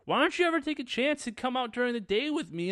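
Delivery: high-pitched acted protest; pleading complaint. Acted quarrel between the couple.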